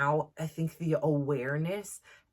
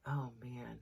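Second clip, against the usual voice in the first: quiet dismay; 4 semitones lower, 10 dB quieter than usual. Retold or imitated. Mother's dismay at conference.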